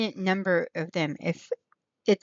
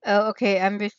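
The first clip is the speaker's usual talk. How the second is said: ordinary talk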